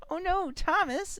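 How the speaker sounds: put-on high little-kid voice; high-pitched, drawn-out mock dismay; child's dismay. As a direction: imitate a small child's dramatic worry.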